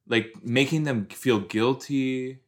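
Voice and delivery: normal talking voice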